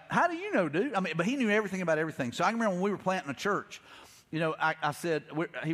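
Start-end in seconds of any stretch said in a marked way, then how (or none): 0.1-0.9 leaps much higher in disbelief; squeaky mock disbelief; incredulous. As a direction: call out his certainty. Doubting a know-it-all friend.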